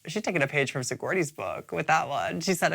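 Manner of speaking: ordinary talk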